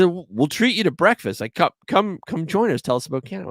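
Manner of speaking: friendly invitation. Retold or imitated. Mexican guys invite him to breakfast.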